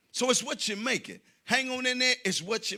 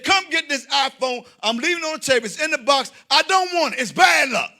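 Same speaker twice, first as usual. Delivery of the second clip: put-on high, loud, upset old mother; fussy mother; 7 semitones higher, 9 dB louder than usual. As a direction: complain like a superstitious mom. Mother voiced refusing the phone.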